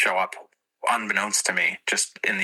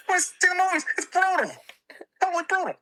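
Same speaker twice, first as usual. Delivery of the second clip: much higher, fast Boston growl of a comic; mock Bill Burr rant, fast and high; Bill Burr impression; faster than usual. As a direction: do Bill Burr ranting about something brutal. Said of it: "brutal is really the only word I can say".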